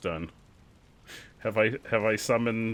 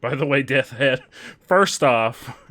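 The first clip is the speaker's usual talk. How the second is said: loud; 9 dB louder than usual. Real, not acted. Addressing chatter.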